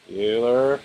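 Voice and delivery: normal talking voice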